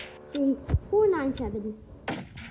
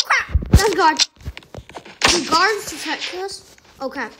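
ordinary delivery

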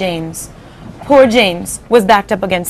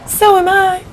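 much higher ladybug cry; 11 semitones higher, slower than usual. Acted. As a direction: squeak it out like a little ladybug. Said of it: "the ladybug cried".

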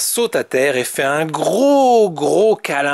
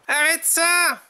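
yelling Tom; 11 semitones higher than usual. A narrator's reading. Tom yells at his friend, high mock yell. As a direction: cry it out high, exasperated. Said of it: "Hurle Tom à son ami".

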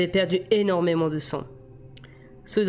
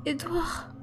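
put-on much higher, softer pained girl's cry; Bella blessée appelle; 11 semitones higher, 7 dB quieter than usual. A narrator's reading. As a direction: call out to him through the pain, in a high small voice. (appel aigu et douloureux de Bella blessée)

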